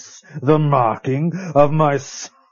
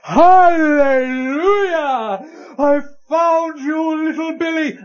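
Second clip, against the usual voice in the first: jubilant cry; 12 semitones higher, 4 dB louder than usual. Acted. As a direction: sing out like a saved man. Merchant finds Billy in the mine.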